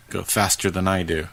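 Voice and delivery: ordinary delivery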